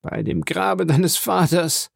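dying man echoes the oath in awe; astonished echo. A narrator's reading. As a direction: repeat the oath in stunned wonder. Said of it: "sagte Caderousse, wiederbelebt".

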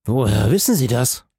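mildly amazed question; a touch of astonishment; astonished. A narrator's reading. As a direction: ask it in wonder. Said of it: "fragte der Hausmeister voll Erstaunen".

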